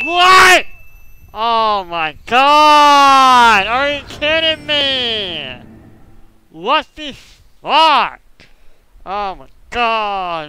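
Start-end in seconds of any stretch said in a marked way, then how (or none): 0.0-5.6 high, loud, stretched-out disbelief; outraged yelling. Real, not acted. Outraged at another game death. 6.5-8.2 high exclamation rising to a shout; angry outburst. Real, not acted. Outraged at game death.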